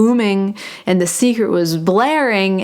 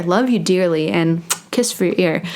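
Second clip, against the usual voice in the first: usual speaking voice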